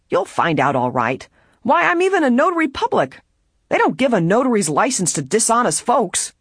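indignant, leaping high in pitch; insisting. A narrator's reading. Trespasser assures the detective of being a notary, insistent. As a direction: insist on your honesty, increasingly high-pitched. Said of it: "the trespasser insisted".

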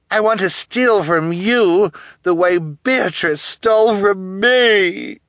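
high, loud, drawn-out villainess; vengeful villainess. A narrator's reading. Guardian nursing old grudge before orphans, loud and vengeful. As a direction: build the grievance, loud and slow.

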